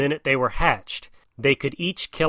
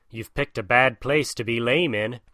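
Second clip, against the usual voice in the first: plain reading voice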